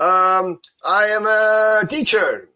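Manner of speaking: high, loud, slow earnest reply; mock wrong answer. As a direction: answer proudly, totally off.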